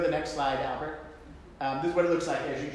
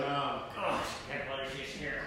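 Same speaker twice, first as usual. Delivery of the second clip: grumbling; 7 dB quieter than usual. Retold or imitated. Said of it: "And then I was grumbling".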